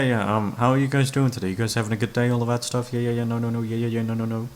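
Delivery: ordinary delivery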